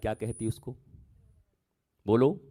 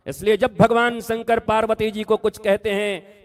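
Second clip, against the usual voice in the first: high, loud impassioned sermon; preaching at full force; 10 semitones higher, 7 dB louder than usual. Acted. Preacher warning the devotees, high and loud. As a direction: hammer the warning home to the crowd.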